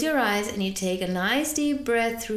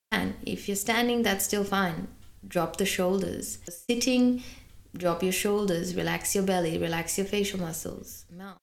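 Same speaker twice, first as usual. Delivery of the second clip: guided relaxation; 2 semitones lower, 3 dB quieter than usual. Acted. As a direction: ease them into letting go.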